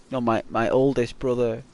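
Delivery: normal talking voice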